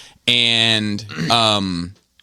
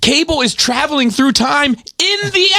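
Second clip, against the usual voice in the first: much higher, slow, shouted point-making; exasperated shout. Real, not acted. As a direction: spell the obvious out to them, yelling. Exasperated rant at fans.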